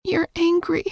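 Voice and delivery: plain reading voice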